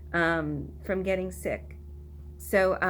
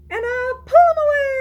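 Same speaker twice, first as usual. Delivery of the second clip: high, loud squeal on away; squealed climax; 10 dB louder, much faster than usual. Shown as a demonstration. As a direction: burst up high and loud as the hands come free. Climax of action song.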